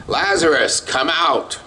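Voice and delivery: calling a dead man out of the tomb, high and carrying; Jesus calling into the grave, loud and high. A narrator's reading. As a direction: call out to the tomb. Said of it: "in a loud voice".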